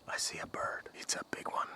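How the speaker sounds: hushed whisper spotting a bird; breathy quiet whisper. Real, not acted.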